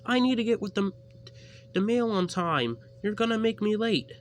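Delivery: high, loud, urgent little engine; high Percy voice. A narrator's reading. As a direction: push hard, you're late for the mail. Percy pleads he is late.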